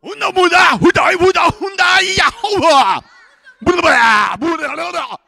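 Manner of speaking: high, frantic war cries in mock Maasai; warrior's yell. Preacher acts the warrior provoking the lion, yelling. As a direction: holler wildly to provoke a beast. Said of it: "He says".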